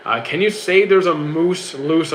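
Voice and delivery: normal talking voice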